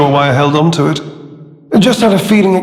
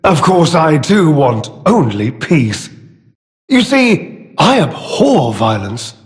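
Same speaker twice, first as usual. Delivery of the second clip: mock sincerity; 2 semitones higher than usual. Villain claiming to abhor violence, theatrically insincere. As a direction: feign noble distaste while savouring it. Villain feigns love of peace.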